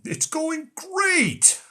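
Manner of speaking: high, drawn-out sarcastic cheer; mock cheer. Parroting what Russians are being told, mock-triumphant and very high. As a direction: stretch it out in absurdly high fake triumph. Mocking Russian official line to the public.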